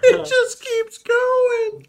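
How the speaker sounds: strained, whiny burn; pained from spice. Real, not acted. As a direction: voice pinched from spicy heat. Hot sauce challenge, burning.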